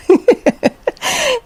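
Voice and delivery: a high squealing laugh bursts out; high burst of laughter. Real, not acted. Mom laughing while retelling her daughter's words, high squealing laugh.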